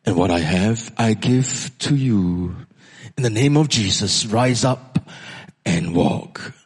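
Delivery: grave apostolic proclamation; Peter. Acted. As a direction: pronounce it deep and measured, with weight. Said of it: "Peter's voice spoke out".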